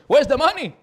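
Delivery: sudden loud, high demand; demanding kid. Retold or imitated. Spoiled kid demanding his allowance, loud and high. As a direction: snap the question at daddy.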